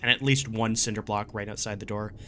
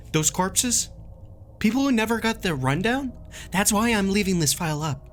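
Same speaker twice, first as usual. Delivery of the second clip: raised, exasperated voice; 8 semitones higher than usual. Real, not acted. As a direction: vent it, voice pitched up. Frustrated rant at readers.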